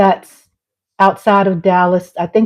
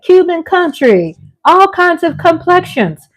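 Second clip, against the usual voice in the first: high-pitched, emphatic outcry; exasperated rant; 9 semitones higher than usual. Real, not acted. Host scolding townspeople over live music, exasperated rant. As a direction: protest in high, swooping exclamations.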